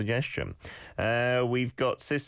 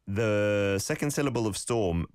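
normal talking voice

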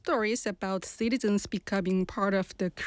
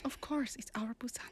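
whisper; 10 dB quieter than usual. Acted. Echoes whispered slogan.

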